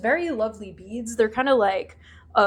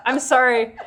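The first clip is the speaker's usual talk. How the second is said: ordinary talk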